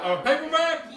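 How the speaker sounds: high, loud disbelief on paperback; incredulous repeat. Real, not acted. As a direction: blurt paperback out in surprise.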